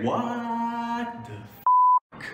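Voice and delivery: very slow, stretched-out, high "what"; long, high incredulous exclamation; stunned reaction. Acted. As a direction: drag out the first word in mock disbelief. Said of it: "But my reaction was like".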